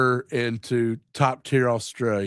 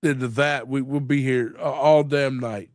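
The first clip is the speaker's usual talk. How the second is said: ordinary talk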